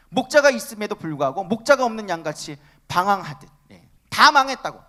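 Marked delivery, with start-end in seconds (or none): 4.1-4.8 acting out Israel's despairing cry, higher and louder; despairing lament. Preacher voicing Israel's hopeless complaint to God, a despairing high cry. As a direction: wail it like a people who have given up hope. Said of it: "하나님 앞에 그렇게 얘기하고".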